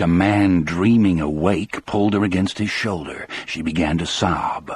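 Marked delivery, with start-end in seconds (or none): none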